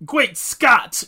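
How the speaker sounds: loud, high startled exclamation; movie quote exclamation. Retold or imitated. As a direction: blurt it out like a shocked scientist. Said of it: "That's a Back to the Future reference".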